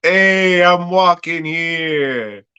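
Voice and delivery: hammed-up Brooklyn accent, slowed down. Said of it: "putting on his fucking Ninja Turtle Brooklyn accent".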